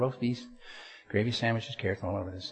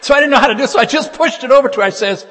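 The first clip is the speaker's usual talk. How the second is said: much higher, much louder, breaking into laughter; carried away by the comic tale; laughing, excited telling; 9 semitones higher, 13 dB louder than usual. Real, not acted. Retelling wife eating his donut.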